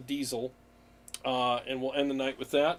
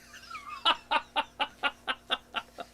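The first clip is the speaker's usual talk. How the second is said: a sudden squealing laugh, much higher; high burst of laughter. Real, not acted. Streamer reading a joke from chat, laughing hard. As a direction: crack up at the chat's joke.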